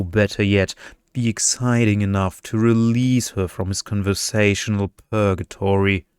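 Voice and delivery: usual speaking voice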